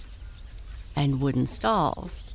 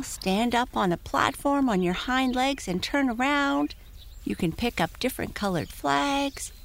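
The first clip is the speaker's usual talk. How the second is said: high excited pony voice; talking pony; 9 semitones higher than usual. Acted. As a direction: list it off like an admiring pony. [name] voice.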